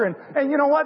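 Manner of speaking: normal talking voice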